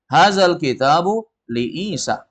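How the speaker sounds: "Hazal kitabu li Isa" slow, ending in a fall; statement intonation. Shown as a demonstration. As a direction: close "li Isa" like a full stop. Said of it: "Anggap aja itu li Isa titik". ("li Isa" turun seperti titik)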